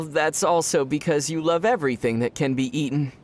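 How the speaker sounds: ordinary delivery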